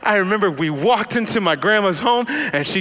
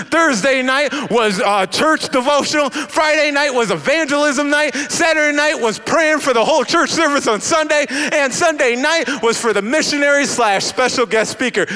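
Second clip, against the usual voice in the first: ordinary talk, nothing marked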